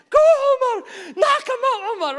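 very high, loud cheer; root for the fighter; excited cheering. Acted. Weak believers cheering.

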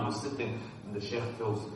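normal talking voice

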